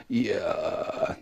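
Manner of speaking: long creaky vocal-fry drawl. Shown as a demonstration. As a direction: drag the word out in a slow creak. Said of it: "that kind of thing".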